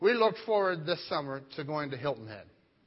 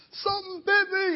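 imitated high, panicked shriek; mock child's scream; 5 dB louder than usual. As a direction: squeal it like a stung little girl. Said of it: "She starts screaming in the water".

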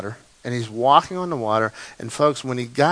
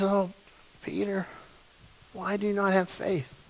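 softer, gentle loving rebuke; tender Jesus; 4 semitones higher, 9 dB quieter than usual. Retold or imitated. Jesus holding sinking Peter, soft and tender. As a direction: lift him up with a soft, loving question. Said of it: "He says".

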